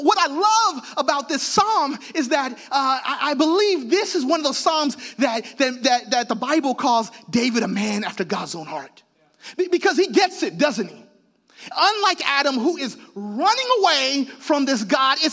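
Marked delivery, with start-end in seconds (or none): none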